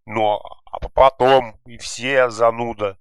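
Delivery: slow, high, loud drawn-out taunt; mocking mimicry. A narrator's reading. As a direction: mimic him to make him look stupid. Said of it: "передразнил Гогу Янкель".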